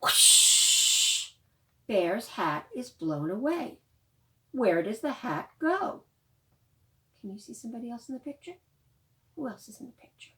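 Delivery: loud, lively storytime reading; reading to children. Acted. Librarian reading The Rainbow Hat aloud to children, lively. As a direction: bring the picture book alive for little ones. Reads picture book to children.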